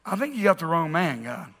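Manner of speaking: low, soft, reluctant admission; hushed doubt, trailing down; self-doubting. Acted. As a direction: confide it humbly, voice dropping away. Gideon doubting himself before God.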